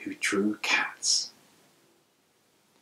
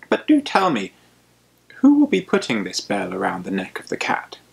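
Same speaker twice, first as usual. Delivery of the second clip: a lightly voiced mouse, mock admiring; wise old mouse; 6 dB louder than usual. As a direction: tease the plan with polite doubt. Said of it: "Mouse stepped forward and he said".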